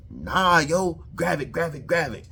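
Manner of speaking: mock an overeager new hire; overzealous new hire. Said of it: "that new overzealous, new hire shit".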